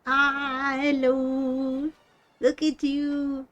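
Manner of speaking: high, slow, bubbly raid greeting; cute anime streamer. Acted. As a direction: squeal a sweet welcome to the raiders. Greeting incoming raiders on stream.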